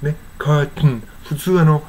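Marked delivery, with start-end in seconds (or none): 0.4-1.0 "カーテン" as English curt-n; no vowel before the final n of "カーテン"; conversational swallowed t. Shown as a demonstration. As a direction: let the t melt into the n in "カーテン". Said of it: "カーテンって言いそうですよね".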